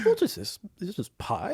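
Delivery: puzzled monarch inspecting unfamiliar food; dainty, quiet royal English accent; Queen of England. Acted. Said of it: "the Queen of England eating apple pie".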